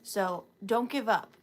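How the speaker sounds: normal talking voice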